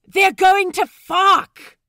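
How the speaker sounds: high, loud, slow holler. A narrator's reading. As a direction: bellow it over the excitement. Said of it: "Pete hollers".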